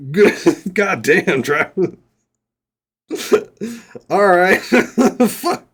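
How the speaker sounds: loud, high, laughing self-scolding; helpless laughter at your own blunder; cracking up. Real, not acted. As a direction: curse yourself through real laughter.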